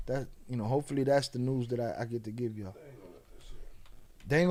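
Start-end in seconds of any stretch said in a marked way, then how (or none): none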